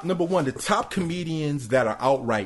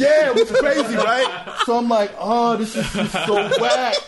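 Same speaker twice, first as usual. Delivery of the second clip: high, loud laughing talk; 11 semitones higher, 5 dB louder than usual. Real, not acted. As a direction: laugh through the words. Laughing while telling dead crowd.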